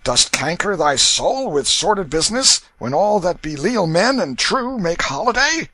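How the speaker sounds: put-on sway it like a merry drunk; tipsy waterman. A narrator's reading. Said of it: "exalted with liquor".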